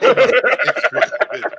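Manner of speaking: laughing hard, squeaky and loud. Real, not acted. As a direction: blurt it out through a laugh. Laughing reply to joke.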